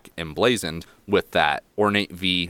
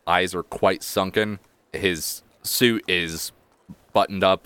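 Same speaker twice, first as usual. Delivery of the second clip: normal talking voice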